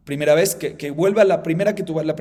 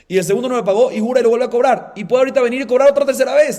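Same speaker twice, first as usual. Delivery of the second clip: building excitement; 7 semitones higher, 8 dB louder than usual. Acted. Teacher builds the argument, rising.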